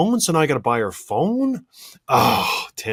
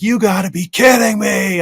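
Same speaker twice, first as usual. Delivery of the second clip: louder, higher exasperated outburst; 6 semitones higher, 6 dB louder than usual. Retold or imitated. Husband reliving his outburst at wife, exasperated shout. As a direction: vent the disbelief out loud. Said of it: "where I was like".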